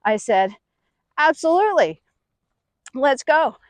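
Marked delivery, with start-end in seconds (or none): 1.2-3.6 bravado. Retold or imitated. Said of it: "not to be outdone by these young friends".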